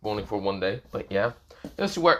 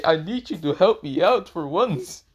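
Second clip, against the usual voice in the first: higher, slower, drawn-out plea; pleading; 6 semitones higher, 4 dB louder than usual. Acted. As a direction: beg a reluctant friend, stretching the words. Character begs friend for help.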